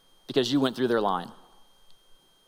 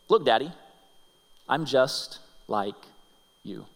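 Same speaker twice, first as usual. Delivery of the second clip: tender refrain; 3 semitones lower, 3 dB quieter than usual. Acted. Daughter's message, slow refrain.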